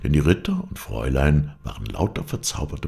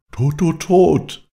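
high, loud echo voice, growing louder; ringing echoes; 14 semitones higher, 7 dB louder, much slower than usual. A narrator's reading. Echoes answer whether he lives, high swelling call. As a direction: swell the repeats like a sounding echo. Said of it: "klangen die Echos".

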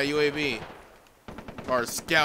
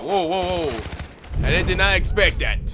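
louder startled whoas; 4 semitones higher, 8 dB louder than usual. Real, not acted. Gamer startled by sudden death, loud surprise. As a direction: caught off guard, loud and up.

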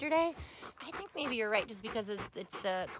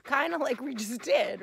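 ordinary talk

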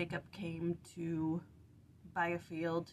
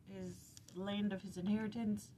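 ordinary delivery

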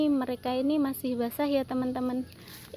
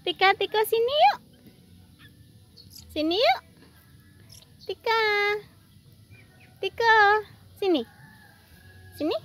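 higher, louder calling Tika; 9 semitones higher, 8 dB louder than usual. Real, not acted. Calling her squirrel Tika to come over, higher louder call. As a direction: call the pet over from across the room.

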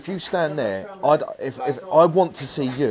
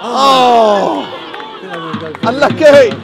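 loud, high cry of disappointment; shouted groan; 9 semitones higher, 9 dB louder, much slower than usual. Real, not acted. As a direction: call out the near miss. Reacting to near miss.

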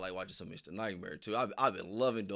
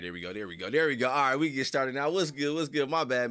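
normal talking voice

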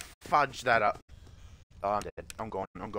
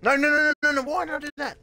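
much higher and louder, frantic no after no; real panicked screaming; 9 dB louder, faster than usual. Real, not acted. Player caught by the animatronic, screaming in despair. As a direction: wail as it all falls apart. Dies to jumpscare.

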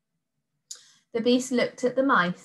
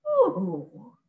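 a tempted monster's ooh; the beast is won over; delighted monster ooh. A narrator's reading. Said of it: "said the terrible monster".

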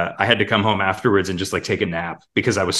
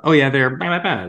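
quick character voice; 6 semitones higher than usual. Shown as a demonstration. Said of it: "the voice that comes out".

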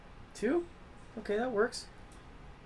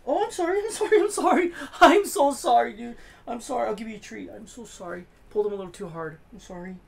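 fond apology; 6 semitones higher, 6 dB louder than usual. Real, not acted. Apologising to his dog, laughing.

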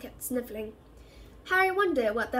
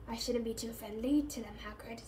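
softer, cool warning; cold; 8 dB quieter than usual. A narrator's reading. Caretaker warns gamekeeper off the students, coldly quiet. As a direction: say it coldly, dropping the volume. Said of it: "said Filch coldly".

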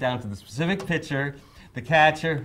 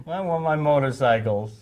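whining; 5 semitones higher, 6 dB louder than usual. Retold or imitated. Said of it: "I was definitely whining".

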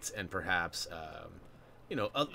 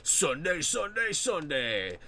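booming rising announcer chant; monster truck ad; 6 semitones higher, slower than usual. Retold or imitated. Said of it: "old monster truck commercials".

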